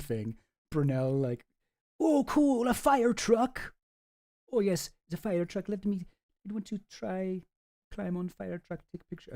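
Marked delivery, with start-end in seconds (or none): 2.0-9.3 put-on a half-hearted French accent, higher and childlike; mock French accent, awed by a fire truck; failed French accent. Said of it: "Okay, I can't do a French accent for some reason".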